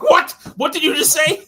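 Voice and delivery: high-pitched, loud indignant question; mock outrage. Boss reacting to being called asshole, mock outrage. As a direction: act affronted, pitch shooting up.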